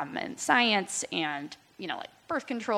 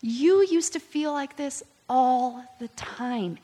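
higher and slower, every word given weight; slow tender; 5 semitones higher, 3 dB louder, slower than usual. Retold or imitated. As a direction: let it land gently and deliberately. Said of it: "He's like".

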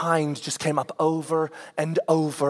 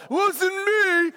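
put-on much higher quick kid denial; kid denying; 13 semitones higher than usual. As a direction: play the innocent child. Kid denies throwing something.

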